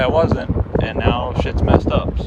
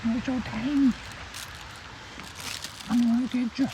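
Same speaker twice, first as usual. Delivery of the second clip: high, cooing pet voice, much higher pitched; 8 dB quieter than usual. Real, not acted. Cooing at a tiny puppy.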